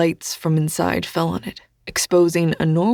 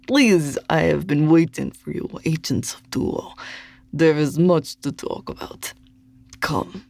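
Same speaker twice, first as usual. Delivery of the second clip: put-on jolly Russian accent like Santa; a genial Santa-like Russian host; amused Russian accent. A narrator's reading. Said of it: "The rich accent made him sound like an amused Santa Claus".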